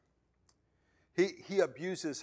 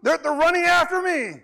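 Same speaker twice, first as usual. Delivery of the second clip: very high, loud, shouting plea for help; a hammed-up victim screaming at the cop; panicked plea; 12 dB louder, slower than usual. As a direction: yell it while fleeing robbers. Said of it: "you're like".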